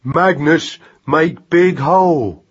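put-on slow, heavy toddler-style effort; excited baby talk. A narrator's reading. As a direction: labour out each word like a hungry infant. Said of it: "forced from him the longest speech".

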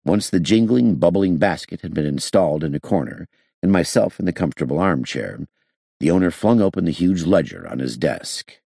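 usual speaking voice

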